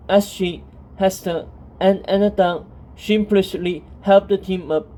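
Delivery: ordinary delivery, nothing marked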